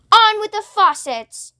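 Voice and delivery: high call from above; shouted. A narrator's reading. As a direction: bellow down from the cloud. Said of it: "it shouted".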